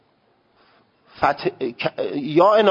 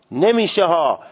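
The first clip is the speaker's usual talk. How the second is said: ordinary talk, nothing marked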